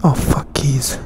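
ordinary talk

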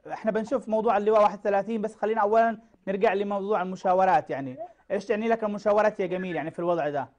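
normal talking voice

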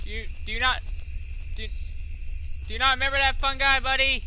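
high whiny begging to a friend; mock pleading. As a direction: plead playfully with the monster. Pleading with chasing mushroom.

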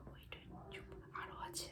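hushed whisper, barely voiced. Real, not acted. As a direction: whisper so nobody nearby hears. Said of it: "আস্তে কথা বলছি".

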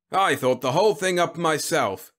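high, loud, boastful General; proud. A narrator's reading. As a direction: puff it out with pride. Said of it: "he said proudly".

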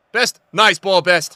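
high, loud praise; thrilled shout of approval; excited shout. Real, not acted. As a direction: call it out to the player. Calling out praise for pass.

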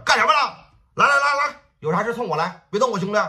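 full-throated angry shout; furious shout. Acted. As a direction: bellow across the crowd to stop him. Brothers shout to stop beating.

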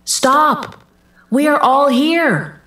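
narrator's understated urgent call. A narrator's reading. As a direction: call out to someone, without a full shout. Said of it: "Paul called out to the jailer".

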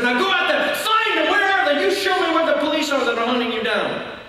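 high, urgent acted commands; forceful challenge. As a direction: dare him to prove the lie, voice pitched high. Evangelist challenges the man's fear.

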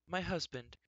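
high and halting after a pause; nervous. A narrator's reading. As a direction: admit it nervously. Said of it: "There was a nervous pause".

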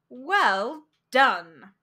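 loud, pleased well done; hearty praise. A narrator's reading. Psychiatrist praising the young man, loud and hearty. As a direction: reward the answer warmly and loudly. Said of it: "The psychiatrist plunged on".

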